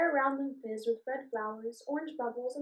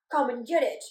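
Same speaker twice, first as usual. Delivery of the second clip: loud taunting yell; rude yell; 8 dB louder than usual. Acted. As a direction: shout it to tease her. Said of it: "yelled the goblin rudely".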